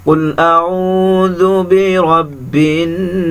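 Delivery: slow, melodic tajweed recitation; measured Quranic chant. Shown as a demonstration. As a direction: chant the verse, vowels drawn long. Recites verse as model.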